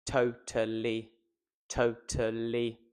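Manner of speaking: "totally" slow in three even, separate syllables; slow, low, evenly stressed "totally"; robotic, like C-3PO. Shown as a demonstration. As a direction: say to-ta-lly like a monotone robot. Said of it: "saying this like R two D two".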